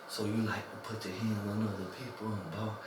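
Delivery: very soft, low retold whisper. Sergeant whispering in an inmate's ear, hushed accusation. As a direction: imitate a hushed threat in someone's ear. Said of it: "when he was whispering".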